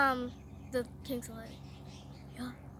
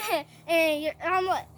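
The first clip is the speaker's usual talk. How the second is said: plain reading voice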